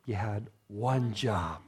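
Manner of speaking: slow, deep, drawn-out "you had one job"; weary parent scolding the kids over the dishes; exasperated parent. Retold or imitated. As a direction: let the disappointment drag, low and quiet. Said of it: "It's like".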